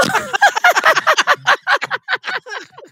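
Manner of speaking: big high cackle; laughing at her own punchline; loud laugh. Real, not acted. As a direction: burst out laughing.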